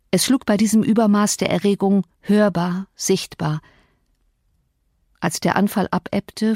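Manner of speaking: ordinary delivery, nothing marked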